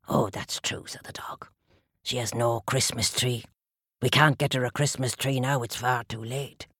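low, friendly dog voice; a cosy, content dog. Acted. Dog character.

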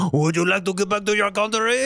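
French caller voice. Retold or imitated.

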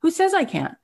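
high, rising self-belief; retold eager daring; Brian Grazer. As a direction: talk yourself into it with growing excitement. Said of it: "he felt so excited".

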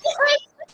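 shrill burst of laughter, very high; high squealing laugh. Real, not acted. Actress laughing at the host's joke, high squeal.

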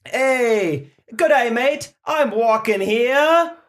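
put-on loud, high Aussie accent with a New York twist; high, loud, slow comic accent mashup; Australian-Boston accent mix. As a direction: mash up Aussie and Boston tough guy. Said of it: "two accents in one".

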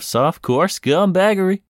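high-pitched shouted farewell; hyped sign-off. Acted. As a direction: sign off with a big flourish. Sign-off flourish.

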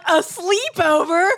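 high, slow mock disbelief; incredulous. Asking the date if it's a sleepover, high and slow. Her incredulous reply in story.

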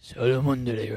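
mock pronouncing. Insists on the odd username.

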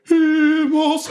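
high, drawn-out spell cry; incantation cry. Acted. Game master acts out spell caster's cry.

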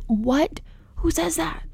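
high-pitched, baffled disbelief; incredulous. Real, not acted. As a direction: ask it in appalled bewilderment. Baffled by the mother's text.

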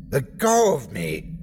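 restrained yell, drawn out; yelling back. A narrator's reading. Pinned officer yelling back at attacker, restrained. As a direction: yell it slowly, without full force. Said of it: "I yelled back".

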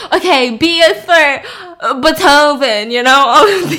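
loud words through real laughter; laughing. Real, not acted. Teller losing it over the composer game, laughing. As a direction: push the line out while cracking up.

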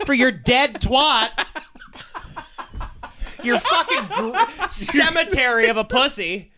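high, loud, cruel jeering voice; mocking husband voice. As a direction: jeer at her loudly in public. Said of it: "You point at a security guard".

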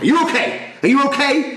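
high-pitched imitation of a loud helper; over-intense check-in, pitched right up; mock loud checking. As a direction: mimic someone needlessly calling out. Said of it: "but so loud".

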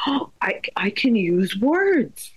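put-on high, slow little-kid wonder; slow squeaky kid voice; toddler's realization. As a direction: voice a child discovering words, slow and high. Voicing son's realization.

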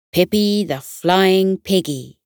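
plain reading voice

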